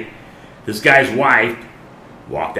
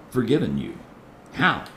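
ordinary talk

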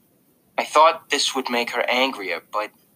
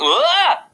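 sudden high scared yelp; a short startled cry; 5 dB louder than usual. Retold or imitated. Jack jumping at the sight of August, high scared yelp. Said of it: "I kinda went".

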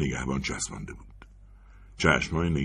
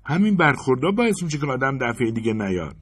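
higher, louder huffy grumble; indignant complaint; 9 semitones higher, 5 dB louder than usual. Acted. Woman in line grumbling about the teller, high and indignant. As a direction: complain loudly so the teller hears.

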